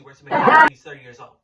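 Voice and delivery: bursting into laughter mid-sentence; laughing. Real, not acted. Laughing while mocking a thirty-year-old coworker. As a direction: laugh it out, fast and loud.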